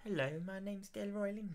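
mock posh British accent, soft and light; a hammed-up posh English voice. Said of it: "really posh accent".

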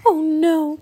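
put-on high girl's cry of dismay. A narrator's reading. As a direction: cry out, stricken. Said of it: "cried Annie".

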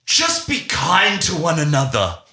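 high, slow, syrupy earnest delivery; mock preachy actor. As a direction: parody a sappy stage moral. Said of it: "turned to the audience and said".

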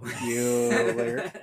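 low, drawn-out monotone impression; Ben Stein. Host repeats the joke for his co-host, Ben Stein monotone. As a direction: drone the name like a bored roll call. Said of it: "say it like Ben Stein".

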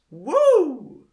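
loud high whoop; mock cheer. Host cheers for Western civilization with a loud high whoop.

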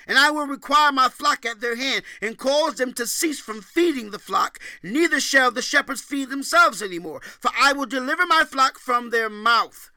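loud, high preached scripture; scripture reading. Acted. Preacher booming scripture to the congregation. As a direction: proclaim the verses like a prophet.

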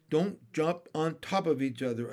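usual speaking voice

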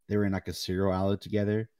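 ordinary talk, nothing marked

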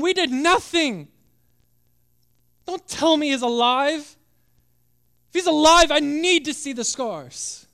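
desperate outburst. Acted. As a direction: demand it in despair. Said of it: "That was his attitude, one of desperation".

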